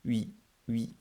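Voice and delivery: Huit in a French accent, lips rounded; French word demo. Shown as a demonstration. Teacher giving a French example of the sound. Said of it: "think of the number eight in French".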